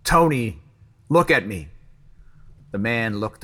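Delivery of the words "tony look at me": raised, urgent call; scream. A narrator's reading. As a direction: shout to make him look, starting high. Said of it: "Jimmy screamed".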